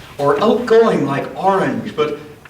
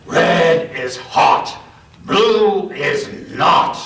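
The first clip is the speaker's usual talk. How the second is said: slow, taunting singsong of a bully; slow schoolyard taunt; slower than usual. Acted. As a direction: drag out the jeer. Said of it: "said what he always did".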